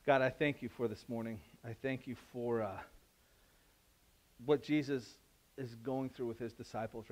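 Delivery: soft, earnest prayer voice. Real, not acted. Pastor praying for the church, soft and earnest. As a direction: pray quietly and sincerely.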